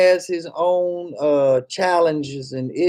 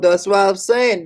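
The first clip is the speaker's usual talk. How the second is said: normal talking voice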